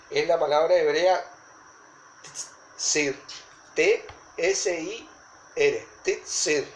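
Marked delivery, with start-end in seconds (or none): none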